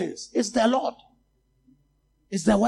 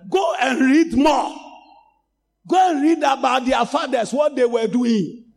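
preaching at full force to the church; fiery preaching; 5 semitones higher than usual. Acted. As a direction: rebuke the congregation at full force.

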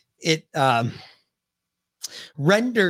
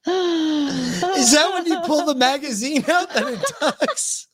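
laughing; 11 semitones higher, slower than usual. Real, not acted. Asks while laughing.

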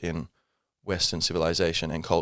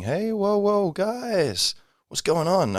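high, drawn-out call to hold on; mock alarmed objection; 13 semitones higher, much slower than usual. Objector calling out to the guys about censorship, mock alarmed. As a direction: halt the crowd with a high-pitched whoa.

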